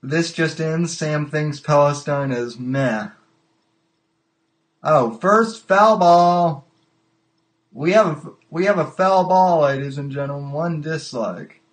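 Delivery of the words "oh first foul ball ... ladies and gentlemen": hammed-up sportscaster bit; mock announcer call. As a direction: announce it like a stadium PA. Host calls foul ball like announcer.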